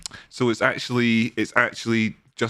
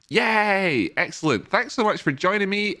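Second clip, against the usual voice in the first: excited; 8 semitones higher than usual. Real, not acted. Cheering new members.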